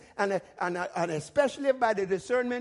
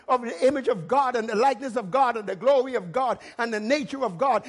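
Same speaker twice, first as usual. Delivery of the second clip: ordinary delivery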